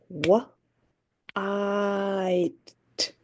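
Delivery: Wa-, I-, t, each sound alone; sounds pounded apart. Shown as a demonstration. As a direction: tap out three separate sounds. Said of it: "I'm gonna pound the sounds".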